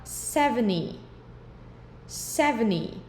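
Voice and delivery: seventy said "sevni", T dropped; seventy without the T. Shown as a demonstration. Teacher modelling seventy for students, T dropped. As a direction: drop the T in seventy. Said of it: "tirar o som de T".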